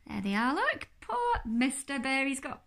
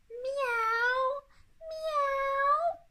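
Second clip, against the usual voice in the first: high, drawn-out miaow; singing cats; 13 semitones higher, 5 dB louder than usual. Acted. Cats sing on the garden wall, high yowling miaows. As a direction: yowl high like a cat. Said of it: "sang the cats on the wall".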